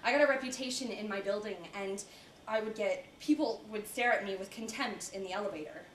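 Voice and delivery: normal talking voice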